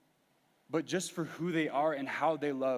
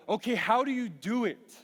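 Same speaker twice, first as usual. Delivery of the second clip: exasperated question; 6 semitones higher, 4 dB louder than usual. Retold or imitated. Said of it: "But I go".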